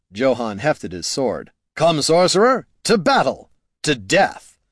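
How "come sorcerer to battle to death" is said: higher, ringing knightly battle call; heroic challenge. A narrator's reading. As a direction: proclaim each phrase as a grand challenge. Knight challenges the sorcerer to fight.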